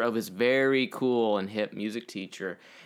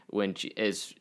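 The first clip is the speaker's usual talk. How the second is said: ordinary talk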